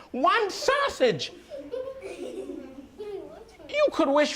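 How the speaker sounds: high, incredulous scolding, half laughing; exasperated wife. Acted. Wife scolding husband over a sausage wish, exasperated. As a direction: scold him for wasting the wish. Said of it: "She got so angry with him".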